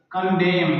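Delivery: ordinary delivery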